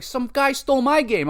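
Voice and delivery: put-on high, complaining little-boy whine; whiny kid. Second crying kid complaining to the player, high and whiny.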